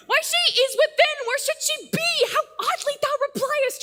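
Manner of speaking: exasperated outburst. Acted. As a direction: throw the question back, irritated. Juliet annoyed at nurse's reply.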